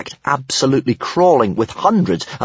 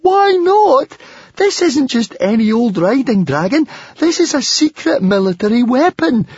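very high squeaky protest; high-pitched squeaking alarm; squeaked; 13 semitones higher, 4 dB louder than usual. A narrator's reading. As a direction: squeal it out in a panicky rant. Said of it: "squeaked Fishlegs".

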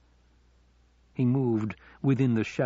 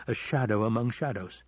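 usual speaking voice

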